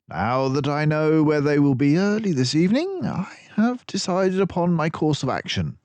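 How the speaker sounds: a clever sleuth delighted with his friend; Basil the detective. A narrator's reading. Basil praising Dawson's deduction, brisk and pleased.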